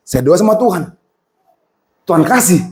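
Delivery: ordinary talk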